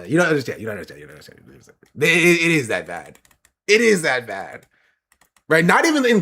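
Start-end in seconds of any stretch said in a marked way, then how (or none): 0.6-1.8 each repeat quieter until gone; fading away. Shown as a demonstration. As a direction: let it fade to nothing. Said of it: "repeated fading".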